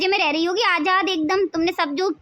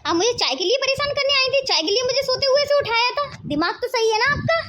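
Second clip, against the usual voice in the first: annoyed retort; 6 semitones higher than usual. Acted. Bahu snaps at mother-in-law.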